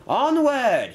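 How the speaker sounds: high, loud rallying cry; cried out. A narrator's reading. Inspector urges the bus to flee, crying out. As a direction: call the charge at high pitch. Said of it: "he cried".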